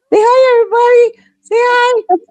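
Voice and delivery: very high, loud squeaky greeting; playful pet voice. Pretend. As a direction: squeal hello playfully on behalf of the cat. Playfully has her cat greet everyone.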